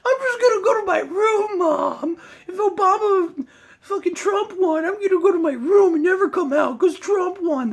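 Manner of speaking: very high, loud, wailing crybaby; hammed-up protester sobbing about Trump to Mom; mocking crybaby. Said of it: "you sound like".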